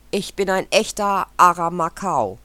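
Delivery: loud, bright boast of a parrot; proud screech. A narrator's reading. Parrot boasting to the club, loud proud screech. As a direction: crow it out with pride. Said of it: "kreischte der Papagei voller Stolz".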